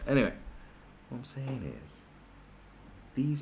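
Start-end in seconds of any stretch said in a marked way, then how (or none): none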